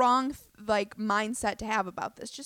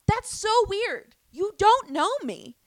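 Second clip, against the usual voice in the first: much higher, louder, very slow "That's so weird"; incredulous drawl; 11 semitones higher, 9 dB louder, slower than usual. Retold or imitated. Telling the comic his remark is weird, a high, slow, exaggerated drawl.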